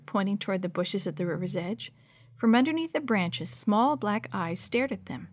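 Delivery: ordinary delivery, nothing marked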